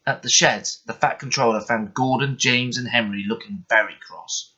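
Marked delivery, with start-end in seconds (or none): none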